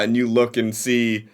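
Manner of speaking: ordinary talk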